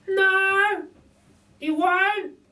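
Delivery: very high, slow, chirpy lady voice; a cheeky cockney burglar lady; Burglar Betty. A narrator's reading. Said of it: "says Burglar Betty".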